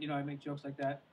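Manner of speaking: usual speaking voice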